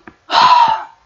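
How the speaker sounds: loud high exasperated groan. Real, not acted. Reader fed up with story, loud exasperated groan. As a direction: groan out in disbelief.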